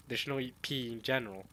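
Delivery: ordinary talk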